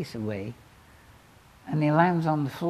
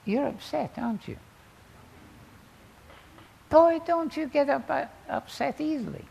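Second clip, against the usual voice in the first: mock perceptive; 9 semitones higher than usual. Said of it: "I say with great perception".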